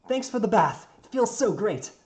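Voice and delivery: high, loud happy call; delighted thanks. Acted. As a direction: call out blissfully from the bath. Builder calls out happily from bath.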